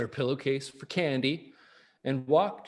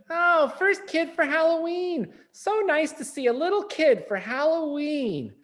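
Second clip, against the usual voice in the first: high, louder, delighted sing-song; sweet high greeting voice; 11 semitones higher, 4 dB louder than usual. Acted. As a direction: coo over a little kid. Lady greets trick-or-treater.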